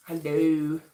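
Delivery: ordinary talk, nothing marked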